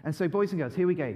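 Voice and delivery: ordinary talk, nothing marked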